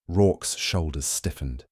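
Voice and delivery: usual speaking voice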